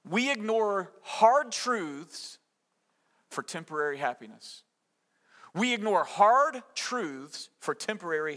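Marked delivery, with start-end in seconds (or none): 0.1-4.6 slow, weighted statement; very slow emphasis. Shown as a demonstration. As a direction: draw out hard truths, slowly and deliberately. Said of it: "To put it another way".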